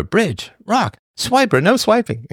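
put-on high-pitched kids' show call; cartoon catchphrase. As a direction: chant it high like a children's cartoon. Quotes a Dora cartoon catchphrase.

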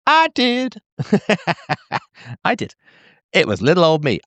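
high, laughing I did; laughing admission. Real, not acted. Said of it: "admits own fault laughing".

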